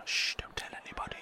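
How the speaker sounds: whisper. Acted. Secret aside about spitting popcorn.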